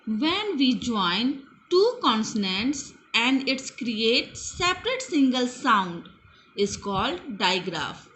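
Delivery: slow, word-by-word English definition; slow dictation. Shown as a demonstration. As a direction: spell out the meaning at writing speed. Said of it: "teacher dictates digraph definition".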